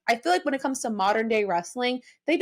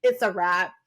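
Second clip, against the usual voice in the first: retold defeat; 6 semitones lower, 4 dB quieter than usual. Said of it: "I was like".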